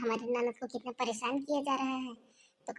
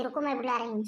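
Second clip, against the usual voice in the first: usual speaking voice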